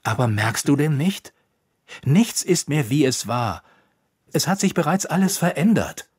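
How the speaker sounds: heated, urgent accusation; angry. A narrator's reading. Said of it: "das Elfenwesen zornig sah".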